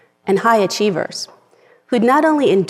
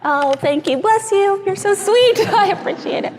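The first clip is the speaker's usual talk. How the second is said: teary thanks; 12 semitones higher than usual. Real, not acted. Tearful thanks for tissues.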